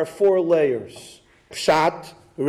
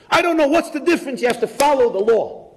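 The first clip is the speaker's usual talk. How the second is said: higher, fast indignant retort; indignant husband; 7 semitones higher, faster than usual. Retold or imitated. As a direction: snap back defensively. Angry husband retorts at rabbi.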